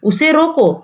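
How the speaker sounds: high "उसे रोको", falling on रोको; stop after रोको. Shown as a demonstration. Teacher reads the second sentence, breaking it after रोको. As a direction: land firmly on रोको as a complete command. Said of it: "अब यहाँ पर दूसरे में क्या दिया".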